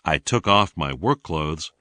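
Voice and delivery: normal talking voice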